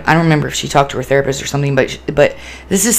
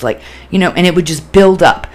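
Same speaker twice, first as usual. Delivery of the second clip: usual speaking voice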